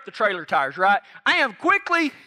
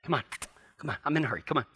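lower, softer, stern coaxing of a stubborn horse; a lightly acted cowboy losing patience; stern talk to horse; 8 semitones lower, 6 dB quieter, much faster than usual. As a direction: mutter at the horse to get in the trailer. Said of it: "That's pretty good, wasn't it?".

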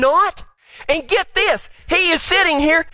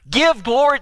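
high-pitched, lightly voiced religious authority; religious people; 9 semitones higher than usual. Religious leaders ordering the healed blind man, lightly voiced officials. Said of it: "this is the religious people talking".

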